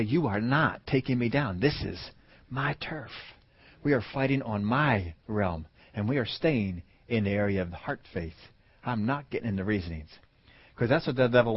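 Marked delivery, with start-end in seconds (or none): 0.0-10.1 measured, hushed resolve; quiet defiance. Acted. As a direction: stand your ground without raising your voice. Acting out a firm declaration of faith.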